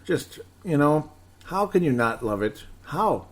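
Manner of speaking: usual speaking voice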